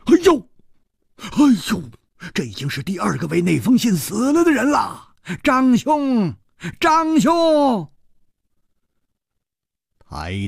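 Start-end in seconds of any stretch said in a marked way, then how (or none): 0.1-7.9 high-pitched cry of shock, then calling out; alarmed. A narrator's reading. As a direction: exclaim in alarm and call urgently for him. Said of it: "冷秋魂变色道". (大惊失色地高声惊呼)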